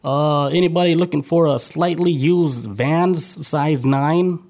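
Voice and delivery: slow street-seller pitch; lightly voiced street seller; hawking pitch. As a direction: sell the shoes slowly.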